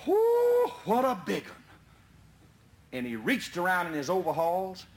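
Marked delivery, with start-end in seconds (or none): 0.1-1.6 sudden high whoop of surprise. Retold or imitated. Climber spotting a big coon up the tree. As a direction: yelp at the sight of it.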